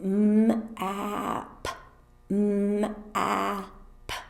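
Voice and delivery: drawn-out sound-by-sound demo; very slow sounds. Shown as a demonstration. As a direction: stretch each sound out slowly, one by one. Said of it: "you say it very slowly like this".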